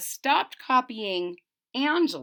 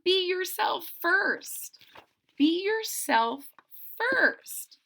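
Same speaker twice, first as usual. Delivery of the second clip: be yourself first, slow and lifted; stressed advice; 8 semitones higher, slower than usual. Shown as a demonstration. Reader stressing the lesson to listeners, slow and emphatic. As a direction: lean on be yourself first slowly. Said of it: "number one".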